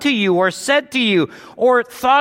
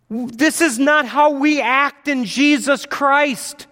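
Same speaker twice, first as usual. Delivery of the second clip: usual speaking voice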